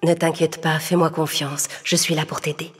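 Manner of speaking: put-on fairy godmother calming a crying girl; gentle fairy. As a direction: soothe her tears kindly. Fairy godmother reassures her.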